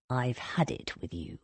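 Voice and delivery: low, quiet menace; steely soft. A narrator's reading. Thomas ordering his cousin out, steely soft. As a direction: say it softly with steel underneath. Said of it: "in a steely, soft voice said".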